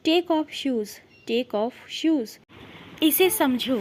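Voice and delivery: normal talking voice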